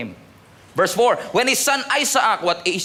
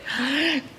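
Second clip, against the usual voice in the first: mock laugh of disbelief; 4 semitones higher, 6 dB quieter than usual. Sarah laughing at the promise.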